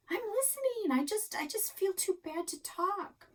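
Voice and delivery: high, put-on pitiful kid voice; mock-sorry girl; whiny sulk. A narrator's reading. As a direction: fake being too upset to talk. Daughter feigns remorse.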